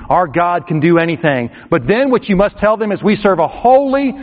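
usual speaking voice

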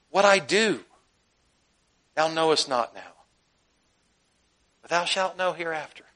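softening, calm, unhurried answer; gentle Jesus. Acted. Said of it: "Jesus answered so gently".

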